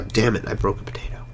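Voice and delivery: whispered under breath; annoyed whisper; whispered curse. Real, not acted. Annoyed aside while breaking crop.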